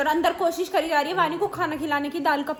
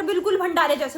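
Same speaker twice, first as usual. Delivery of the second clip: ordinary delivery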